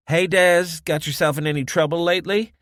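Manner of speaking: high, loud, quick teasing hail; cheery barkeep. Acted. Josie hailing Desmond across the bar, high and teasing. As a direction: greet an old friend with a cheeky poke.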